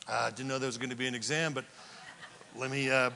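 low, soft, hurried excuse; flustered stalling. Acted. Husband fumbles when wife asks sorry for what, flustered. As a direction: play a husband caught unprepared. Husband caught out by wife's question.

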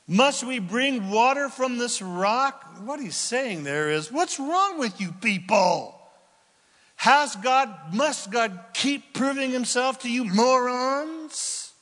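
a touch of slow, indignant scolding; exasperated rant. Acted. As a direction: hammer home his frustration. Moses' angry quote paraphrased.

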